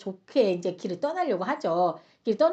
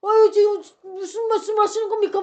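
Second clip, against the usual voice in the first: high, loud and flustered; acted alarm, voice jumping up; panicked protest; 9 semitones higher, 8 dB louder than usual. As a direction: stammer out a shocked denial. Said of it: "얼굴이 사색이 된 거죠".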